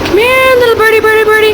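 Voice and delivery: high sing-song coaxing call; coaxing a wild bird. Pretend. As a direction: lure the bird in gently. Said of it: "calling the bird over".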